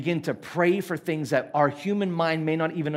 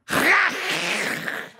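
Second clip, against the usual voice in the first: high-pitched mock foreign noise; imitated foreign sounds; 12 semitones higher than usual. Traveller mimicking a language he doesn't know, mock noise. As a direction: make a strange, high imitation of unknown speech.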